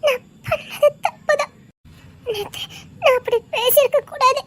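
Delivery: soft, crying admission of fault; girl apologising to the man in tears; tearful apology. Acted.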